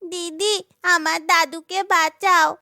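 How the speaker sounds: tearful plea. Acted. Turtle's granddaughter begs Chandrika to save grandfather, tearful. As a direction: beg big sister with a touch of tears. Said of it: "কাঁদতে কাঁদতে".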